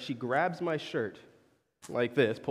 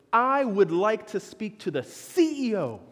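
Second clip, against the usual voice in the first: mock entitled demand; 4 semitones higher, 3 dB louder than usual. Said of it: "He goes".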